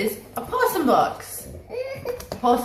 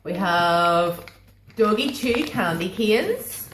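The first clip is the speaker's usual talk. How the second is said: usual speaking voice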